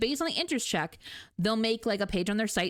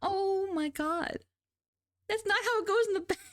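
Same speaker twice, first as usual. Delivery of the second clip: high disbelief; 11 semitones higher than usual. Real, not acted. Reacting to chat teasing.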